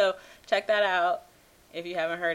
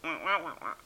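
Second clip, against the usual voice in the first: muffled wah wah talk; 4 semitones lower, 6 dB quieter, faster than usual. Retold or imitated. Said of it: "All right, Charlie Brown".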